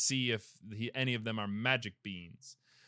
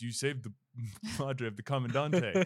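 a mock bull voice breaking into laughter. Retelling the movie, voicing the bull with a laugh. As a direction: ham up the bull, laughing through it. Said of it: "And then the bull goes".

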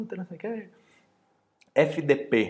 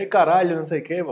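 higher, much louder blurted swear; loud careless swearing; 6 semitones higher, 7 dB louder, faster than usual. Retold or imitated. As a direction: blurt the swear out at the dinner table. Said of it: "na mesa, né".

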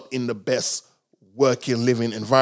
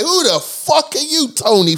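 mock outburst; 12 semitones higher, 5 dB louder than usual. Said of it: "I'm like".

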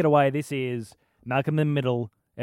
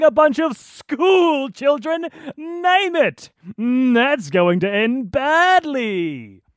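big, booming, higher and louder voice, slow; Brian Blessed impression; 11 semitones higher, 5 dB louder, slower than usual. Host voices Hal as a booming larger-than-life actor. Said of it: "I was doing Brian Blessed".

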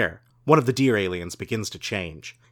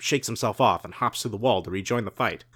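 ordinary delivery, nothing marked

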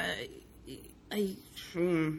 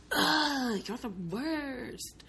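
hammed-up wail, higher, dragged out slowly; mock teen whine; 6 semitones higher, much slower than usual. Teen daughter to parents about London, mock sulky whine.

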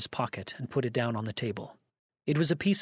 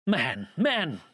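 mildly raised, higher exclamation; impatient outcry, pitched up; exasperated cry; 5 semitones higher, 4 dB louder than usual. A narrator's reading. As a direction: cry out in exasperation, without a full shout. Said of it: "cried Campbell".

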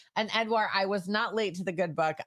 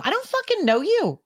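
irritated rant; 14 semitones higher than usual. Real, not acted. Ranting about callers.

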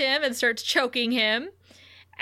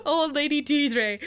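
laughing; 4 semitones higher than usual. Real, not acted. Laughing while saying name.

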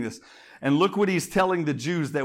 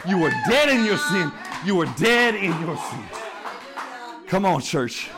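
louder, higher preaching voice; fervent preaching; 4 semitones higher, 6 dB louder than usual. Acted. Pastor urging church toward the gospel, raised preaching. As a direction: press it home to the congregation.

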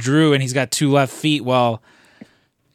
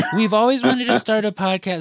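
put-on high gushing girl voice, much higher; excited girl voice; 8 semitones higher than usual. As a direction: squeal it out eagerly. Imitating date's gushing line.

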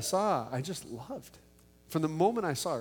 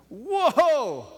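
high, loud whoop of joy; excited whoa; 12 semitones higher, 6 dB louder than usual. Retold or imitated. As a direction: shout it out, thrilled by the news. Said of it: "I was so excited".

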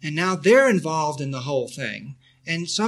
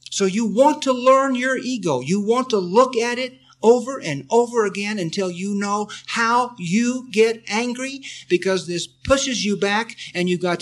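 louder, higher earnest insistence; emphatic urging; 5 semitones higher, 7 dB louder than usual. Real, not acted. Teacher urging students to learn their ego, loud and emphatic. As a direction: press the point home hard.

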